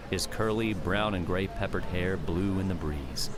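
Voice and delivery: ordinary delivery, nothing marked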